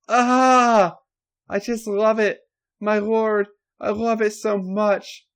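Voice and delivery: high, loud, moaning cries, slow at first; moaning in pleasure. A narrator's reading. Stephanie answering her lord with high loud moans. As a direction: moan every word up high and loud.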